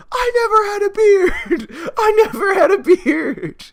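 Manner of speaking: very high, loud cracking cry-yell; overacted sobbing shout with laughter breaking in; hammed-up wailing. Neil shakes the girlfriend, very high loud crying yell. Neil shaking her, yelling.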